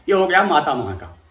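ordinary delivery

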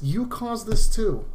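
ordinary talk

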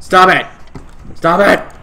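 loud frantic yelling; shouting. Real, not acted. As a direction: yell it out in a panic. Yelling at the game.

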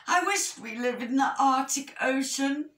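high-pitched wishful complaint; mildly moany storybook bear; moaning. A narrator's reading. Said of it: "moaned Medium Bear".

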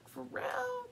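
breathy whisper, high; whispered awe. Retold or imitated. Partner whispering in awe about the reader's loyalty. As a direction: hush it like a secret. Quoting person's awed reaction.

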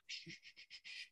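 soft unvoiced shushing to keep a sleeper down; hushing whisper. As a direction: shush them so the boy keeps sleeping. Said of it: "you just see Allie's like".

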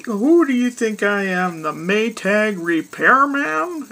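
gruff husband. Retold or imitated. Husband cutting off his timid wife, gruff and loud. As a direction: drag it out with scorn. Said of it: "he gruffly looks at her".

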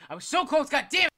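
high, loud angry yell; frustrated yell. Real, not acted. Frustrated yell after losing.

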